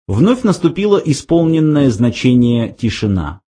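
ordinary delivery

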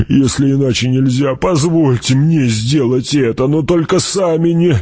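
starts restrained, builds to high urgent pleading; impassioned old retainer. Acted. Gurney begs Paul to let him do the killing, impassioned. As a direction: climb from a heavy appeal into desperate insistence.

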